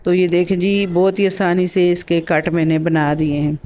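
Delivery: ordinary delivery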